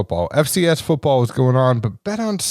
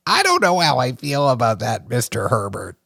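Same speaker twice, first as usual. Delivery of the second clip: imitated character. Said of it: "I just think of, like".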